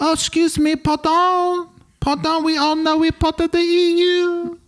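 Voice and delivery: high, mock European accent; put-on European accent. Burglar claiming to be part of EU, mock European accent.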